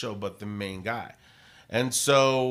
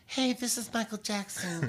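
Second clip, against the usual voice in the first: much higher put-on pop star voice; 10 semitones higher, 4 dB quieter than usual. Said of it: "I did a couple voices".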